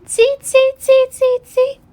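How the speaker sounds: very high monkey squeaks; chattering monkeys. A narrator's reading. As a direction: squeak back like cheeky monkeys. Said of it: "monkeys only stamped both their feet".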